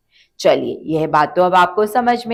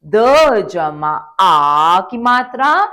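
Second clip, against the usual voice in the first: द जमा आ जमा आ और जमा त, syllable by syllable, slow; spelling it out slowly; 3 dB louder, much slower than usual. Shown as a demonstration. Teacher spelling out दांत for children, slowly. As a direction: sound it out piece by piece, very slow. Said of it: "मिलाते हैं".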